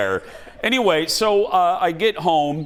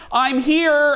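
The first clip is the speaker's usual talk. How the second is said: a bright, higher call from downstairs; calling out cheerfully; 12 semitones higher, 3 dB louder than usual. Retold or imitated. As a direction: sing out your arrival to the house. Friend calling out on arrival.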